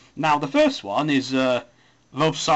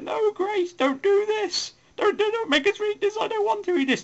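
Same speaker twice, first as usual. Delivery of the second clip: very high squeaky pleading voice; pleading flatmates. Retold or imitated. Flatmates begging Grace not to make them read, in a high squeaky whine. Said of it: "They're like".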